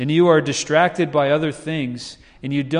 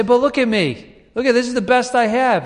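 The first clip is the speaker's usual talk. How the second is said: much higher, louder whiny plea; we plead with God, higher and louder; mock pleading; 10 semitones higher, 4 dB louder than usual. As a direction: show off your best, mock desperate. We plead our goodness to God.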